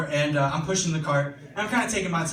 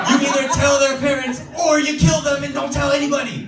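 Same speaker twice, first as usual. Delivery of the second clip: higher and louder, shouting over the chaos; loud exasperated yell; 9 semitones higher, 5 dB louder than usual. Retold or imitated. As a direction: yell at mom to make her see sense. Kid yelling back at mom.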